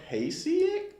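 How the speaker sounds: Pasiak tried as PAY-see-ick, questioning; unsure pronunciation. Real, not acted. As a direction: slowly sound out Pasiak with a long A, rising. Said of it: "I don't know this word right".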